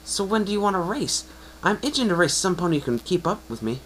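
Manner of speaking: high, bold, cocky pony voice; eager sporty mare. Acted. Brash pony sizing up the newcomer, high and cocky. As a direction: voice a brash, competitive young mare, pitched well up. Rainbow Dash challenges to race.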